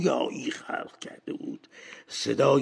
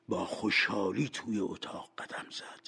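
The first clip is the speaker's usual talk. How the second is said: ordinary delivery, nothing marked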